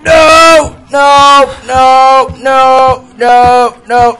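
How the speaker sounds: high, loud panicked screams; yelling while running away. Real, not acted. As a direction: scream no over and over. Caught by the monster.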